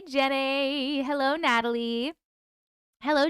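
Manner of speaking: ordinary talk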